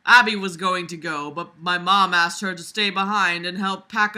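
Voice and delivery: loud, projected read-aloud; story narration. A narrator's reading. Vlogger reading a story aloud to listeners, loud narration. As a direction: read it out like an audiobook, punchy.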